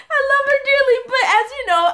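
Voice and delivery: high, loud, through laughter; laughing. Real, not acted. Fondly laughing about her mom, high and loud. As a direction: gush through real laughter.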